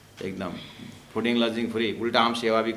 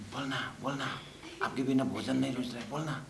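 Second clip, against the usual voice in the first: hushed prompting; 9 dB quieter than usual. Acted. Daughter-in-law whispering prompts to child.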